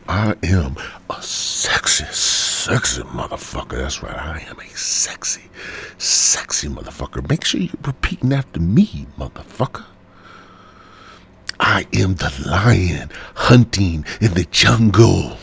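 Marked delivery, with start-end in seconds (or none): 0.1-7.3 sultry whisper. Acted. Sexy whispered affirmation. 11.6-15.4 slow powerful affirmation. Acted.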